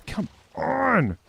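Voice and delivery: high, drawn-out groaning plea; exasperated effort; strained frustration. Real, not acted. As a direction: strain it out at the stuck cart. Cart stuck on hill.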